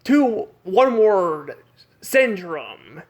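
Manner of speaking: slow, drawn-out dramatic announcement; one word at a time, dramatically; dramatic reveal. Shown as a demonstration. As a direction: stretch each word out for drama. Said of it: "I meant to say it like this".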